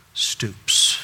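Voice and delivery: single word breathed out; whisper. Acted. Preacher to listeners, breathy whisper.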